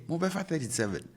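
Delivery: Mobefa thirty-seven pitched high as a question; question tune. Shown as a demonstration. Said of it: "that becomes a yes or no question".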